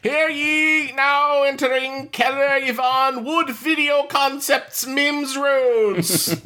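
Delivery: high herald proclamation; stiff ceremonial announcing. Pretend. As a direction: proclaim the guest like a royal herald. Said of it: "you have a herald come in".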